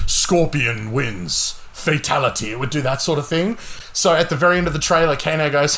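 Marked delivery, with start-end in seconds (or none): 0.1-2.5 slow, drawn-out mock announcer call; game announcer. Imitating the game's announcer for a friend, slow announcer call. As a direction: boom out the win like the game does. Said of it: "it would go like".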